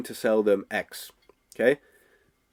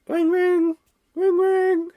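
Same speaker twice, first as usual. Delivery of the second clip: high mock phone ringing; 4 dB louder, much slower than usual. Coach mimics the phone ringing, high and sing-song. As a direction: ring like a telephone, high up.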